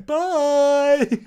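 high, drawn-out "bye" held long; mimicked goodbye. Host mimicking a film character's one shot, high mock goodbye. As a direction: sing out an exaggerated, loud goodbye. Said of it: "One shot of her going".

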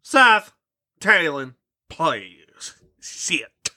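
a hint of a grave vampire dad telling his tale; lightly voiced dramatic father.

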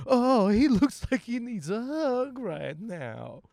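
mock cooing. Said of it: "I've never seen a swat team guy and went".

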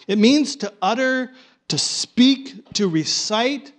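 ordinary delivery

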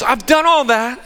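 put-on the rich young man begging Jesus, eager and high; eager rich kid. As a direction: gush like a kid desperate to please. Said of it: "this young rich kid".

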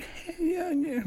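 imitated high-pitched voice. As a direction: mimic a very high caller. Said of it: "real high-pitched voice".

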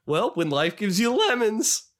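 high, rising mock punchline; Vine-skit impression. Husband catching his wife's eye, high hammy punchline. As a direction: climb in pitch like a hammy quote. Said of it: "catch my wife's eye and say".

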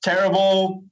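hammed-up annoyed coworker; mock cursing. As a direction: grumble at a crashing database in a mock fed-up voice. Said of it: "people cursing everywhere".

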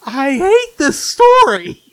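high, loud, drawn-out complaint; exasperated outburst. Real, not acted. Co-host confessing dislike of Gift of the Magi, exasperated outburst. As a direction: blurt out real loathing, voice shooting up.

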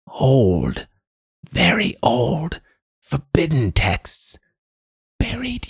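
put-on high, halting old woman, partly whispered; addled old crone. A narrator's reading. Old woman confiding forbidden secrets to the man, high crone voice. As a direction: eke it out in short, high fragments.

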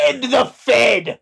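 high, slow rallying shout; shouted slogan. Retold or imitated. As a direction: yell it like a protest chant.

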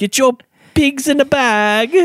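street hawker. Pretend. Mock seller calling out wares.

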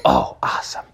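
hushed whisper; whispered excitement. Acted. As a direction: breathe it softly. Whispered aside in bedtime story.

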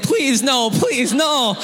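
put-on high pleading child voice; scared kid begs officer not to cut; begging kid.

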